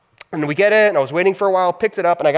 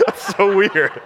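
laughing; 3 semitones higher, slower than usual. Real, not acted. Laughing at his own story.

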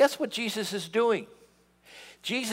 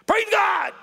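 much higher, loud hammed-up praise; mock praise shout; 14 semitones higher, 5 dB louder than usual. Preacher imitating a loud worshipper, high mock shout. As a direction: shout it like an over-eager worshipper. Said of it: "with them going".

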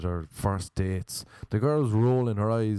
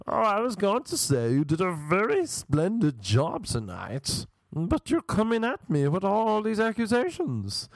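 high, swooping mock-posh voice; theatrical English-sounding man; 9 semitones higher than usual. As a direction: protest grandly in an affected English put-on.

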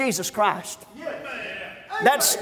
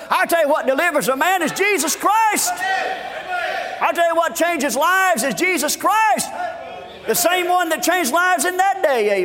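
higher, ringing revival cry; impassioned preaching; 6 semitones higher than usual. Real, not acted. Preacher telling congregation what changes lives, impassioned proclamation. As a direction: proclaim it with full preacher's fervour. Preacher peaks on Jesus Christ.

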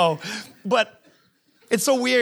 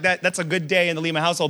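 normal talking voice

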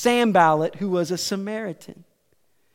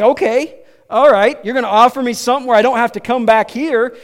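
acted exasperated agreement; 4 semitones higher, 9 dB louder, faster than usual. Acting out the woman's eager bargaining.

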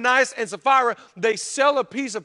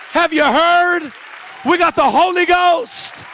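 high, slow, ringing proclamation; excited good news; 7 semitones higher, 4 dB louder, slower than usual. Shown as a demonstration. Preacher announcing the good news to the crowd, excited proclamation. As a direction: call it out high, stretching the words. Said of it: "in an exciting way".

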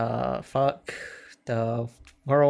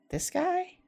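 disbelieving; 11 dB quieter than usual. Retold or imitated. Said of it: "and you're like".

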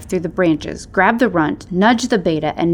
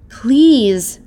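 higher, louder strained plea; pleading; 5 semitones higher, 6 dB louder than usual. A narrator's reading. Stranger begging the alpha to hear him, pleading. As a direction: beg as a wounded creature. Wounded stranger begs to be heard.

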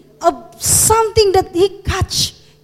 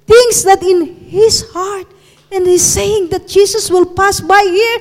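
ordinary delivery

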